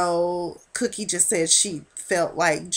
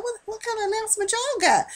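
much higher, fast, sassy challenge; Cookie's retort; 13 semitones higher, faster than usual. Retold or imitated. Cookie challenging Camilla's announcement, high and sassy. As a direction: fire the question back with attitude.

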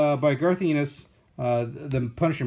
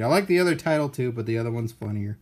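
ordinary talk, nothing marked

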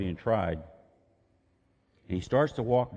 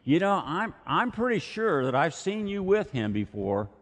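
put-on insistent servant girl; 8 semitones higher than usual. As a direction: lean in on him, sure of it.

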